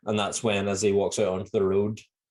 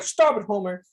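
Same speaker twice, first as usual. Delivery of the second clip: high mock scolding Marge; a hissed plea to stop; imitating Marge; 10 semitones higher, 3 dB louder than usual. Marge telling Homer to stop pointing, mock scold. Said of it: "she's like".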